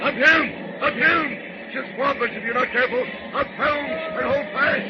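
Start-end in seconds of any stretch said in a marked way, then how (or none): 0.0-3.8 yelling at the helm. Acted. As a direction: holler over the wave. Captain yells at helm in storm.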